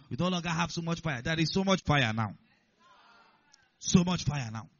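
loud, fervent preaching; impassioned sermon delivery; preaching at full force. Real, not acted.

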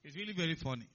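normal talking voice

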